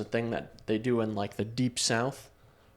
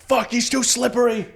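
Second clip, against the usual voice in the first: high, loud frantic werewolf yell; frustrated werewolf; 11 semitones higher, 8 dB louder than usual. Retold or imitated. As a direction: yell as the werewolf losing its grip. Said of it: "The werewolf's like".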